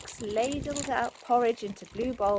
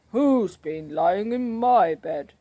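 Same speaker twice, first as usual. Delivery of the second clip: a hint of a growly bear, louder and slow; growly father bear; 8 dB louder, slower than usual. A narrator's reading. Father bear complaining about his bed, lightly growled. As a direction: rumble it like a lightly voiced papa bear. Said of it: "in a growly voice".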